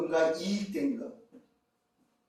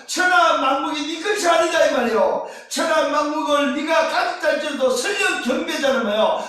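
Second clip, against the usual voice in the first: raised, driving pulpit delivery; forceful preaching; 9 semitones higher, 11 dB louder than usual. Acted. Preacher to congregation on the temptation, forceful preaching.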